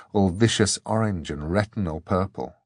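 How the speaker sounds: ordinary delivery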